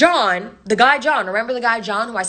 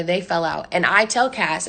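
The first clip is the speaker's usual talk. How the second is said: ordinary delivery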